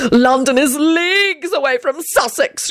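high, drawn-out protest; angry scream. Acted. Grindlittle defends himself to Arthur, high angry cry. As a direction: protest it high and slow in indignation. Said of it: "Grinliddle screamed angrily".

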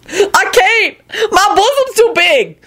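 high, wailing woman; panicking Lucretia voice. A narrator's reading. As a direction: protest helplessly, way up high.

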